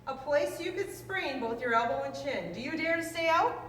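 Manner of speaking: usual speaking voice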